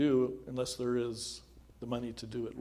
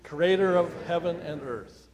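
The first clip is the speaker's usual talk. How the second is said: louder, rhythmic recitation, proclaiming each phrase; reciting the creed; 3 semitones higher, 6 dB louder than usual. Acted. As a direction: lead the congregation in the creed with conviction. Leads the Apostles' Creed aloud.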